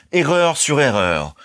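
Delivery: slow, high, scornful repeat; contemptuous. A narrator's reading. Colonel dismissing Ernest's theories, slow scorn. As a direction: drag out each word in disdain.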